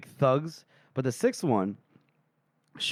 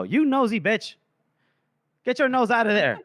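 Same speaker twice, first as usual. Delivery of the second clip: mock scolding; 7 semitones higher, 3 dB louder than usual. Real, not acted. Scolding a character mockingly.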